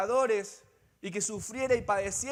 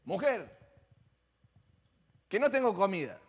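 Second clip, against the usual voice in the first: a mildly irritated snap at his wife; mock-annoyed complaint, slightly louder; half-hearted irritated "Mujer"; 4 dB louder than usual. Shown as a demonstration. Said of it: "cuando alguien se enoja y le dice".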